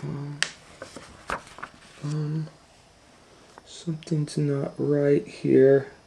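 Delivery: put-on small girl voice, unsure and hesitant; Kate, hesitant. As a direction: hesitate like a nervous little girl. Kate's worried inner voice.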